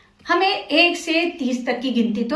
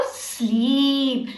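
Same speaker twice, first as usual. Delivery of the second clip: stretched out very slowly like drifting off; slow dreamy sleep voice; sleepy drawl; 4 dB quieter than usual. Acted. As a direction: let the words yawn into sleep. Teacher acting out sleep for kids.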